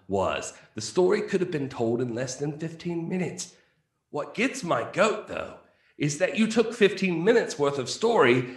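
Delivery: reading a review in a mock British accent; put-on British accent. Host reads a one-star review aloud in a put-on British accent. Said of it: "I'll read this in a nice professional accent".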